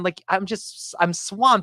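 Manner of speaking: normal talking voice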